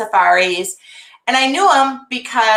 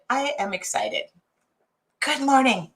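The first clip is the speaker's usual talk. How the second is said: usual speaking voice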